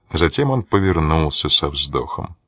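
plain reading voice

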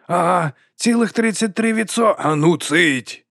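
high-pitched character squabble; heckling retort. A narrator's reading. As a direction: jeer up high, then hush it slowly. Band squabble, retort and hushing.

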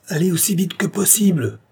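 high, carrying call; calling through cupped hands. A narrator's reading. Orianov to Tatiana's carriage across the slope, high distant call. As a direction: send the order across the distance. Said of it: "en se servant de ses deux mains comme d'un porte-voix".

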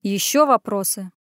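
mildly gruff question; a touch grumpy; grunted. A narrator's reading. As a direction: grunt it curtly. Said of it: "буркнул".

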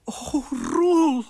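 high, loud exclamation; amazed delight. Acted. Queen sees her changed husband, high and amazed. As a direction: exclaim it in astonished admiration.

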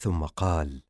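plain reading voice

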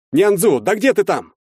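high, loud yell; alarmed shouting; impatient shout. A narrator's reading. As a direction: call urgently to someone upstairs. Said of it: "закричал я".

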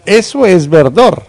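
high, loud catchphrase; loud exclamation. Acted. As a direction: shout it out.

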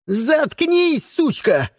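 high, furious snarl; strained angry outburst. A narrator's reading. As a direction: growl it at the hostage. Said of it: "зарычал на неё Сиприт".

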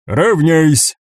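clipped drill command; stiff barking toy soldier. Acted. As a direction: bark it like a sergeant on parade. Drill command to goblin ranks.

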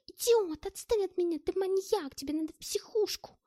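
high, loud screech; shrieking. Acted. Pinned girl shrieking at her attacker, high loud screech. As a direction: scream it in fury. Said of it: "визжала Маша".

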